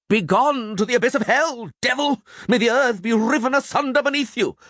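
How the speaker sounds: very high, frenzied curse; priest's shriek. A narrator's reading. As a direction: hurl the exorcism out in a high cry. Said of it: "the priest shrieked".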